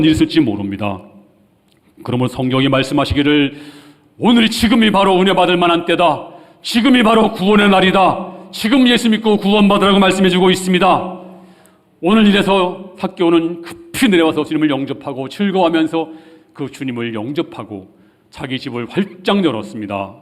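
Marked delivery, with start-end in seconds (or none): 4.2-16.1 high, loud full-force sermon delivery; urgent preaching. Real, not acted. Preacher to congregation on salvation today, urgent preaching. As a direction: proclaim it urgently at the top of your voice.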